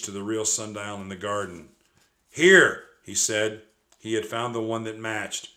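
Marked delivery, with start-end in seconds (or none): 2.3-2.9 exclaiming. A narrator's reading. Jack finds the match.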